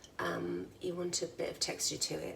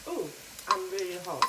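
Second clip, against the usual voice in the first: talking with a mouthful; mouth full; 6 dB louder than usual. Real, not acted. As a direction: say it through a hot mouthful of food. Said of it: "Being with my mouth full".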